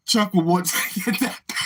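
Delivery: words collapsing into laughter; real laugh breaking up the sentence; helpless laughing. Real, not acted. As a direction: try to finish the line while cracking up. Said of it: "I can't stop laughing".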